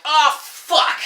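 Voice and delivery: high, loud frustrated cry; exasperated outburst. Retold or imitated. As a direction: blurt it out, fed up. Exasperated reaction retold to audience.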